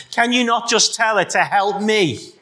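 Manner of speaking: angry demand. Acted. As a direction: snap it at the Lord as annoyed Martha. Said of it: "angry spirit".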